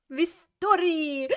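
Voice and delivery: high, stretched-out triumphant finish; hammed-up proclamation with an exclamation mark; exclamation-point finish. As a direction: draw it out grandly, pitched high. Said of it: "Exclamation point".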